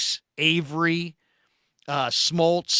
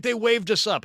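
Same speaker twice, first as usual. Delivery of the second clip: first claim, a little higher and light; casual insistence; 7 semitones higher than usual. Retold or imitated. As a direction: brush off the worry casually. Buddy insists they were waved on.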